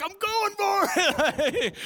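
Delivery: triumphant mock battle cry in the last yards; sprint yell. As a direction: shout it while kicking for the finish. Final sprint to beat friend.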